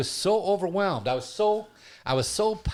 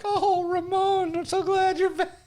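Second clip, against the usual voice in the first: put-on much higher, slow, breathless fan girl; swooning girl; 3 dB louder than usual. Much higher swooning woman greeting Ramon. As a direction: gush like a smitten woman. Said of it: "Every girl in the place is like".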